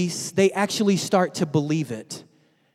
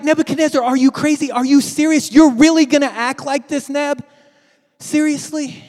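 loud, high disbelief; can't believe what he's seeing; incredulous; 7 semitones higher, 6 dB louder than usual. Retold or imitated. Listener calling out to the king in disbelief, loud. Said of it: "And you're like".

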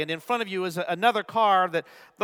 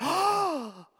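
high crowd gasp, imitated; mock-shocked intake of breath; audible gasp; 10 semitones higher than usual. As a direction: gasp like horrified onlookers. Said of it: "the whole crowd went".

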